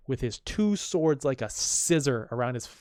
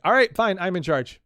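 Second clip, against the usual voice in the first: exasperated giving in; 5 semitones higher, 6 dB louder than usual. Retold or imitated. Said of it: "Kaiser's like".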